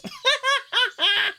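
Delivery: high, bright giggle; Alex laughing at Marco's oops; cat's laugh. Acted. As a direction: burst out laughing at him. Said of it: "Alex laughs".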